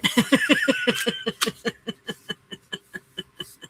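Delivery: long laugh trailing off; squeaky, amused laughter; high giggling laugh. Real, not acted. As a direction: giggle until out of air. Laughs after country is cornbread.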